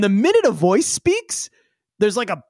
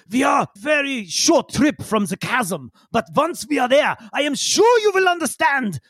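put-on high accented voice climbing higher; super wound-up character; 7 semitones higher than usual. Yigsel promising the crew they will understand, super wound up. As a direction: proclaim it excitedly in a put-on accent. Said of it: "he's super wound up".

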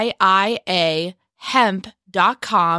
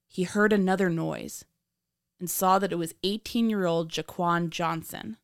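true crime narrator; 10 semitones higher than usual. A narrator's reading.

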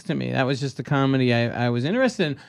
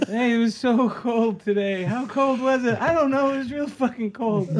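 high, tearful comedian voice; mock sobbing; 7 semitones higher than usual. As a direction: push the joke out in a high mock wail. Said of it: "I'm sobbing".

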